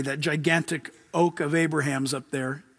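normal talking voice

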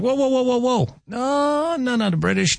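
high-pitched hammed-up "whoa, no no" of upset fans; mock alarmed protest. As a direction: squeal it like offended Scots cutting someone off. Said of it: "They're like".

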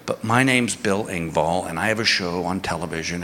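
hushed sheepish name-drop; sheepish low murmur. Retold or imitated. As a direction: drop the voice like a reluctant celebrity. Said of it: "I go".

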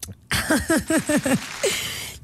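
long breathy giggle with a rising tune; helpless laugh. Real, not acted. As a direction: laugh you can't stop. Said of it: "no puedo". (carcajada incontenible)

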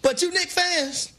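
high, slow call to the congregation; mock preaching. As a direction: summon the fans like a preacher. Turns to mock sermon.

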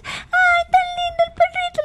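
high-pitched cooing at a puppy; gushing pet talk. Retold or imitated. Child mimics adult fussing over the dog, gushing. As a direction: coo excitedly over a cute dog, way up high. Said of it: "porque tú le dices".

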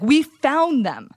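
loud, high, drawn-out excited announcement; all excited. Retold or imitated. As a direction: announce it like breathless big news, stretched out. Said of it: "all excited".

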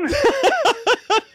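high rapid giggle; laughing fit. Real, not acted. Customer guesses who is calling, prankster giggles helplessly.

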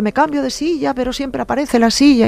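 imitating a tearful child's complaint, high-pitched; queja llorosa imitada. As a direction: plead in a high, mock-weepy little voice. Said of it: "yo lloraba y yo decía". (tono lloroso fingido, agudo)

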